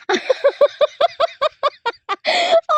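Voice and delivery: long, loud, high-pitched laugh; high laughing fit. Real, not acted. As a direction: burst into a high cackling laugh. Giggling fit on the climb. (malakas at matinis na halakhak)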